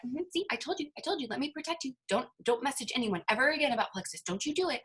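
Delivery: put-on high, soft little-kid scolding; eight-year-old self. Child self scolding her from the shoulder, high and soft. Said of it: "your little eight-year-old self is sitting there on your shoulder".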